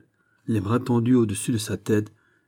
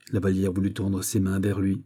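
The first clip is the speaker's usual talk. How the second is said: plain reading voice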